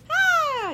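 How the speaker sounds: high, drawn-out "ah" of mock delight; overexcited squeal. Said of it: "very excited and happy about life".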